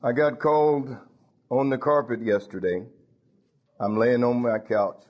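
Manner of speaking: ordinary delivery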